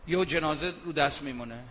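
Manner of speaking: usual speaking voice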